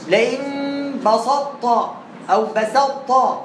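بسطت very slow with a full ط, then with a full ت; wrong reading demo. Shown as a demonstration. Teacher imitates the common wrong readings, slow. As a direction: mimic how everyone says لئن بسطت. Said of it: "غلط".

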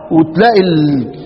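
ordinary talk, nothing marked

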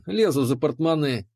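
plain reading voice

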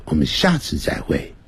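ordinary delivery

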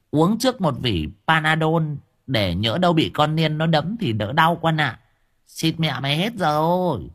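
high old woman mocking with glee; cackling old woman. A narrator's reading. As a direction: tease him like a delighted old busybody. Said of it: "tiếng cười khanh khách khoái chí".

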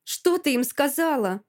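furious interrogation; growled demand. A narrator's reading. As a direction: growl the question at her. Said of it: "рычу на неё".

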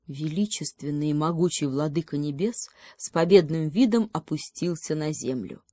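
ordinary delivery

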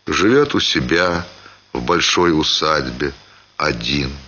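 usual speaking voice

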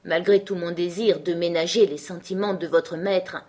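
usual speaking voice

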